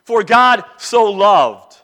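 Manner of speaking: loud, slow, weight on "God" and "so loved"; emphatic verse reading. Shown as a demonstration. Preacher meditating aloud on a verse, emphatic stress on "so loved". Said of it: "emphasize the words in the text".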